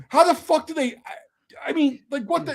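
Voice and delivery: can't believe what you just saw; incredulous. Retold or imitated. Soldier asking the corpsman how he copes, high and incredulous. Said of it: "I'm like".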